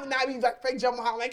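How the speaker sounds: ordinary talk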